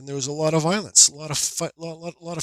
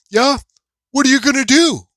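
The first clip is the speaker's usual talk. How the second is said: loud, high mimicked goading; retold defiant taunt; 9 semitones higher, 8 dB louder than usual. Other driver taunting him from the truck, retold defiant taunt. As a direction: mimic a guy calling your bluff.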